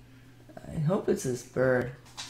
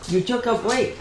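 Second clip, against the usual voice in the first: put-on baby talk; 9 semitones higher than usual.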